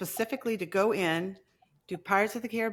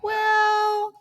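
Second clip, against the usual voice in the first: exasperated; 7 dB louder than usual. Real, not acted. About to yell at the dogs.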